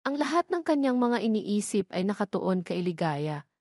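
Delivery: ordinary delivery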